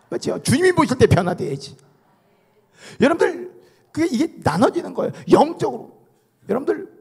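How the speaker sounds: high, urgent sermon voice; emphatic preacher driving the warning home; forceful preaching. Acted. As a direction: press the congregation with pointed questions.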